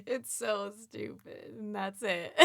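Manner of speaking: laughing through the words; cracking up, slow and breathless; amused. Real, not acted. Breaks up laughing at ending.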